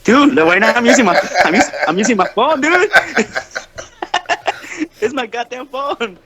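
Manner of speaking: laughing. Real, not acted. Laughing defence of his cheap microphone.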